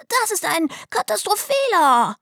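put-on high-pitched indignant child's complaint. A narrator's reading. Delly objecting to house arrest, indignant high wail. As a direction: protest in a high, outraged little voice. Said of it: "beschwerte Delly sich empört".

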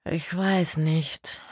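slow, hesitant reply; halting and unsure. A narrator's reading. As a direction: draw it out, unsure. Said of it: "sagte Kerstin zögerlich".

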